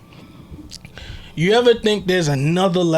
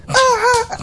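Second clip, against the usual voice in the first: a sudden high yelp, half laughing; mock scream. Retelling noisy neighbors with a high yelp. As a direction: yelp it out like someone startled.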